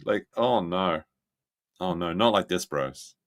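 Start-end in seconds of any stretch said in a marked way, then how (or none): none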